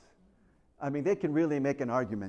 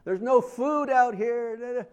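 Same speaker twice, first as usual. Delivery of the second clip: whiny murmuring; 6 semitones higher than usual. Retold or imitated. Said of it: "they murmured".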